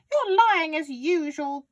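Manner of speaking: high, loud granny accusation; yelling grandma. A narrator's reading. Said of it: "Grandma yelled".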